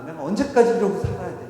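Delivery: a touch of exasperated anger; fed-up anger. Acted. Overworked mother going to wake daughters, fed up. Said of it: "오늘은 화가 많이 났어요".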